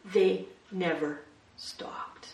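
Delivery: slow breathy whisper of the phrase. Acted. Preacher whispering the sermon's one point to the congregation. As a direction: whisper it like a secret.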